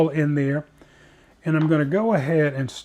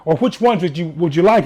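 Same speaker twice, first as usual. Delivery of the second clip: louder mock Italian waiter; lousy Italian accent; 3 semitones higher, 8 dB louder than usual. Italian server offering a choice of mushrooms, lousy Italian accent. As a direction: imitate the Italian server, badly. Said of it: "I'm doing a lousy Italian accent".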